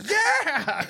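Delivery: high excited yell back; matching the hype. Retold or imitated. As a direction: cheer back at him, caught up in it. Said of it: "And I was just like".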